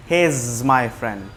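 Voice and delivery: "he is my friend" very slow, is said as "iz"; slow z linking. Shown as a demonstration. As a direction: drag it out, voicing is as iz. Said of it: "he is, is, এটা তো I S". (ধীরে ধীরে, is-কে জ দিয়ে উচ্চারণ)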